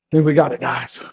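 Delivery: ordinary talk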